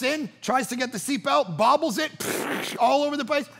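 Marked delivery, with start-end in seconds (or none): 2.1-2.7 whooshing spill sound effect; imitated spray noise. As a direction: mimic a can spraying everywhere. Imitates the can bursting open.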